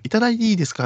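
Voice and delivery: high sugary hostess plea; hostess asking. Retold or imitated. Hostess repeating her drink request, high and sweet. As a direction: coo it like a club girl asking.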